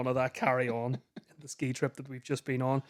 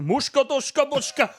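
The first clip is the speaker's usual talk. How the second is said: higher, louder gibberish war cry; mock foreign raider; 10 semitones higher, 4 dB louder than usual. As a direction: bark it as a fake foreign bandit.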